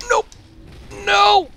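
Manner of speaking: sudden high yelp of no; high panicked shout. Real, not acted. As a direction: cry out in alarm. Reacting to attack.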